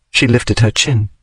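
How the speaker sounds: ordinary delivery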